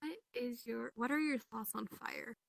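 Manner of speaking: normal talking voice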